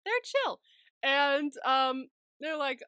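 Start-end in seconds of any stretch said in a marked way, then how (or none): 0.1-0.6 high, loud playful repeat; playful high echo. Pretend. Host echoes the co-host's verdict, playful high echo. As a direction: pop it up in pitch.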